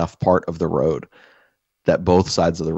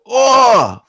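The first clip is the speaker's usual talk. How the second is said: loud, high-pitched "oh" of pain; imitated pained cry; 8 dB louder than usual. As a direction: cry out as if pinned to a wall. Imitates buddy pinned to the wall.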